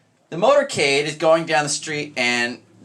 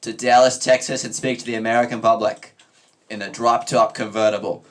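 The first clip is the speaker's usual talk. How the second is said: put-on JFK accent; 2 semitones lower, 3 dB louder than usual. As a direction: announce it like a statesman with a parody Boston accent. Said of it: "What, the J F K accent?".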